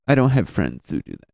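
ordinary delivery

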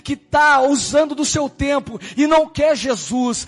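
prophesy with fervor. Retold or imitated. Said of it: "ela falou". (profecia fervorosa e arrastada)

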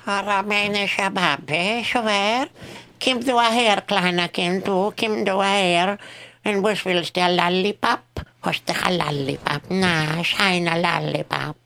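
put-on heavy Eastern European accent; Russian character voice. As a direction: play the old Russian grandmother offering a lollipop. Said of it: "whenever [name] impersonates a Russian".

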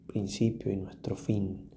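ordinary talk

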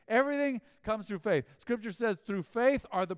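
high, ringing preacher voice; preaching. Acted. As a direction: proclaim scripture to the church.

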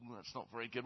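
soft, sucking-teeth disapproval; quiet disappointed [name]. Acted. [name] softly disapproving of the man's answer, quiet. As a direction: tut quietly over the clipboard.

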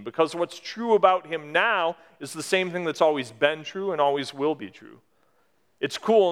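ordinary talk, nothing marked

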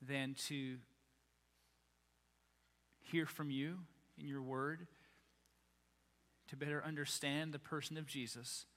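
soft, low prayer. Real, not acted. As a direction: lead a congregation gently in prayer, low and hushed. Leads a prayer.

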